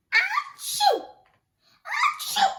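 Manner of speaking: huge high loud sneezes; big sneeze. Acted. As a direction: blast out two enormous achoos. Bear sneezes in story.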